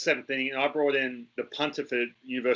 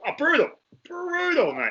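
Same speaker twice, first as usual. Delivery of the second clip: high, emphatic comic verdict; exaggerated exclamation; 12 semitones higher, 4 dB louder than usual. Commentator asked how the punter was, high exaggerated exclamation. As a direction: pitch way up, hammer it for comic effect.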